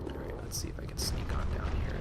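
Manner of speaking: hushed whisper while sneaking past. Real, not acted. Player sneaking past a gunman, whispering. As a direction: breathe it out so the enemy won't hear.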